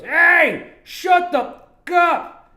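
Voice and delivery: high, very loud retold yell; mock angry shout. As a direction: imitate a passerby yelling down an alley. Imitates passerby yelling at streamer.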